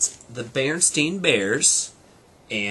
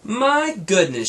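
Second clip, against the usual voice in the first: complaining; 10 semitones higher, 8 dB louder, slower than usual. Acted. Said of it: "she complained".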